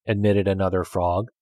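ordinary delivery, nothing marked

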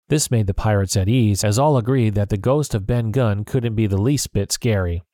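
ordinary delivery

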